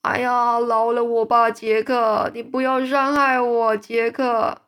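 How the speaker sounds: loud, panicked pleading; begging for mercy. A narrator's reading. Giant begs Jack in a nightmare, loud and frightened. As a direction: beg Jack to spare him.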